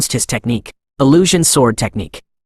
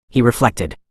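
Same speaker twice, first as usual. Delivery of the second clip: usual speaking voice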